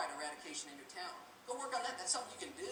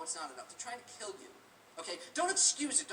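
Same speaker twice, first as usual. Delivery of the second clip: ordinary talk, nothing marked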